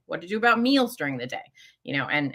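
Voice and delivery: normal talking voice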